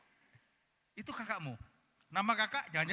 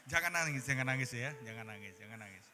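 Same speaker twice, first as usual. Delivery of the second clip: 1 soothing laugh; 6 semitones lower than usual. Real, not acted. Soothing child while laughing.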